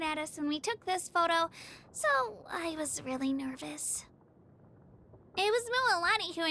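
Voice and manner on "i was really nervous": breathy shy whisper, almost voiceless; nervous whisper. Acted. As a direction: confess it under your breath. Admitting nerves about the photo.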